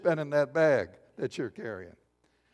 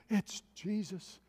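awestruck woman; 3 semitones higher, 10 dB quieter, slower than usual. Retold or imitated. Imitates a woman simply believing.

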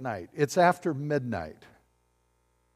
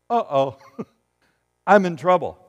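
mock Saul; 5 dB louder than usual. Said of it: "see Saul going".

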